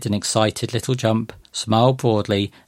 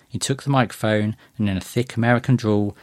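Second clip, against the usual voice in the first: ordinary delivery, nothing marked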